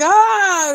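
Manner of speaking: high mock yelp; startled high cry. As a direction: let out a sudden high yelp. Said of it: "And I was like".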